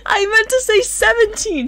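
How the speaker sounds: high loud laughing protest. Real, not acted. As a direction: laugh through the words. Laughing at own mistake.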